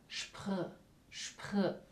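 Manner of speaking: "Spr, spr" as a soft breathy consonant cluster; isolated consonant cluster. Shown as a demonstration. Teacher isolating the German sp sound for students. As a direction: hiss out "Spr" with a throat R, barely voiced. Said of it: "suena como sp. Y la R acá sí viene de la garganta".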